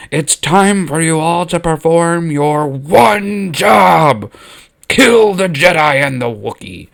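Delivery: slow, high, loud Sith order; villain's command. Acted. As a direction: bellow it like a tyrant. Emperor orders guards to kill.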